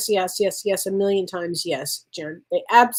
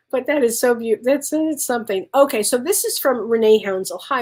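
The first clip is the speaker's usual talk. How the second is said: ordinary delivery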